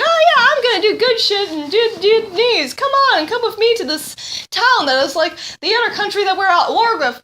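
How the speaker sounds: high, quick imitation of Rose; mock Rose voice. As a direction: rattle it off like an overeager heroine. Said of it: "Rose is like".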